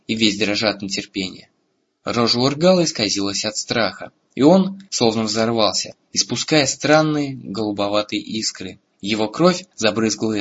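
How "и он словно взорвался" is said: usual speaking voice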